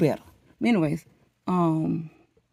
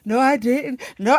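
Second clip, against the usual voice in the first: high, loud retold denial; mock-distressed refusal; upset protest; 6 semitones higher, 6 dB louder than usual. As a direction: push back hard against the praise. Retold tearful refusal of congratulations.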